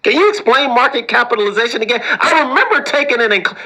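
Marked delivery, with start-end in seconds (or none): 0.0-2.0 high, eager voice faking a simple question; pretend smart person. Harvard grad asking the host to explain market cap, mock eager. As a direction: play a know-it-all who hides not knowing. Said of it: "this is how people that are intelligent, but they don't wanna say they don't know it".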